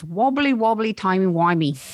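put-on Doctor Who in her best English accent. Quoting a listener's Doctor Who line in an English accent.

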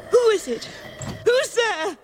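scared call. Acted. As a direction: call out to an unseen presence. Mother calling into the dark.

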